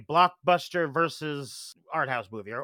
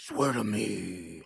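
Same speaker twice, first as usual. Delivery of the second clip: low, slow, softer Batman impression; 8 semitones lower, 6 dB quieter, much slower than usual. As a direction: drag it out in a deep mock superhero voice. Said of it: "yeah, they just".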